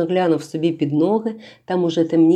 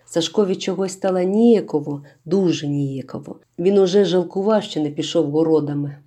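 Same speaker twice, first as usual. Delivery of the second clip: plain reading voice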